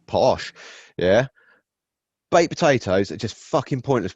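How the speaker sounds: ordinary talk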